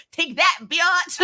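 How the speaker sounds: high, laughing gloat over the rescue; gleeful gloat. Retold or imitated. Viewer cheering as Riku snatches Rion: laughing high gloat. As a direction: cackle out take that, biatch. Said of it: "And I'm like".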